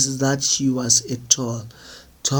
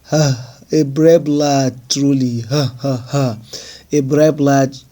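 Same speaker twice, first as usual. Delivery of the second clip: mocking laughter; 3 dB louder than usual. A narrator's reading. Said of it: "I roared with laughter".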